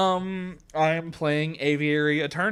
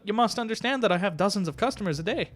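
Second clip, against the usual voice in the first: lilting shopkeeper slipping into Scottish; accidental Scottish accent; 4 semitones higher than usual. Acted. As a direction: protest with an unsteady Scottish-like lilt. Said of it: "Now I've switched to Scottish".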